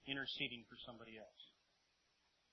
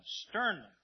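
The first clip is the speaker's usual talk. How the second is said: STERNLY punched higher and louder; emphatic repeat; 6 semitones higher, 9 dB louder than usual. Shown as a demonstration. Preacher underlines the warning word, emphatic. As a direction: hit "sternly" hard on a high falling pitch.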